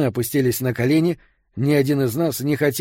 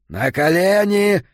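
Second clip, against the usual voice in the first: higher, louder, slow buzzing command; nasty buzzing shout; 6 semitones higher, 4 dB louder, slower than usual. Acted. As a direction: bark the order in a nasty buzz. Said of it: "крикнул Аарон своим противным жужжащим голосом". (противный жужжащий крик)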